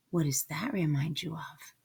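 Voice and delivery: soft whispered aside. Real, not acted. Reader whispering an aside to the listening children. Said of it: "aside to listeners".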